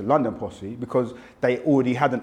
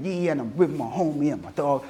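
put-on Yankee rap accent; mock American hip hop accent; American rapper; 3 dB quieter than usual. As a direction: imitate an American rapper's swaggering talk. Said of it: "American rapping".

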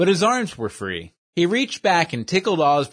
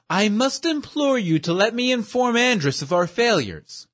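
higher, fussy, overly formal voice; pompous gentleman; 8 semitones higher than usual. Acted. Portly schemer praises his dim companion, high and prissy. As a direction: play a prissy know-it-all praising his henchman.

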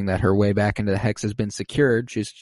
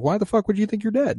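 much higher, fast baffled quote; incredulous squeal; 12 semitones higher, faster than usual. Retold or imitated. Vision baffled by Agnes, high fast disbelief. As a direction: rattle it off in shocked confusion. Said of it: "And he's like".

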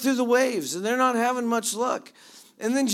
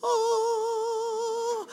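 high, long drawn-out gasp of wonder; mock amazed oh. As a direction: stretch it up high in fake awe. Mock awe at glowing Jesus.

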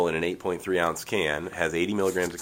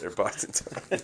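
breathy whispered repeat of "Boston Tony", much softer; whispered aside; 2 semitones higher, 7 dB quieter than usual. Shown as a demonstration.

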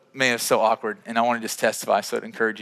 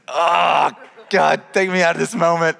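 exasperated, louder mock groan to God; mortified plea; 3 semitones higher, 5 dB louder than usual. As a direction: beg to escape an awkward moment. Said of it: "you ever feel awkward, right?".

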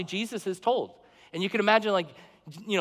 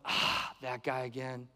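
softer, sighing disdain; weary contempt; 6 semitones lower, 8 dB quieter than usual. Retold or imitated. Congregation sneering at the poor man, soft and weary. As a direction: dismiss him with a tired sigh. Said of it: "Everyone's like".

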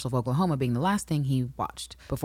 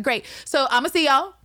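breezy high mock Adrian; 7 semitones higher, 3 dB louder than usual.

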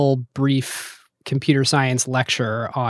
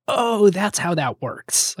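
slow, higher aha moment; the lightbulb going on; delighted realization; 6 semitones higher, slower than usual. Retold or imitated. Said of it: "being like".

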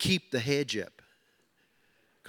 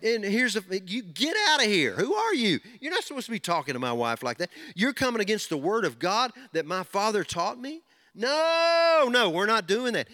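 higher, forceful challenge to an intruder; Adam standing up; 5 semitones higher than usual. Retold or imitated. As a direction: confront the serpent and drive him out. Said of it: "He should have said".

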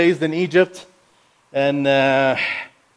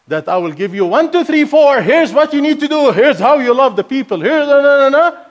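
rattling off a list, much higher and louder, mocking; mock formula; 9 semitones higher, 5 dB louder than usual. Speaker mocks listeners who expect a formula, high and loud. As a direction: send up a step-by-step sermon, then cut it off.

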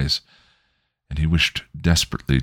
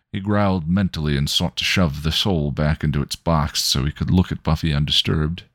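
plain reading voice

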